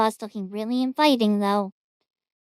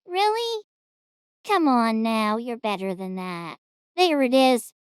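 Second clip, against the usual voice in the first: normal talking voice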